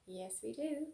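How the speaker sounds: plain reading voice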